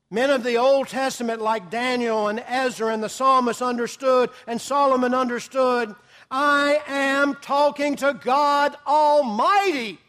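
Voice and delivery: fervent sermon build; fervent preaching. Acted. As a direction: swell up to God Almighty. Preacher swells again.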